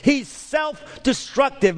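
slow, loud preaching shout. Real, not acted. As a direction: hammer each word out to the back row. Preacher peaks on the demoniac.

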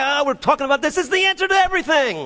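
gushing, high and fast; excited. Acted. Preacher imagining next week's passage, excited. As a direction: build to a high, rapid exclamation. Same enthusiasm for next week.

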